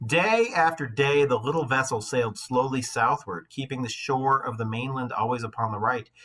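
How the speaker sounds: lightly lifted read-aloud voice; steady storybook reading; story narration. A narrator's reading. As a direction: read the myth to the class. Reads the myth aloud.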